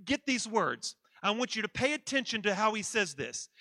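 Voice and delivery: normal talking voice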